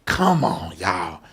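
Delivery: ordinary talk, nothing marked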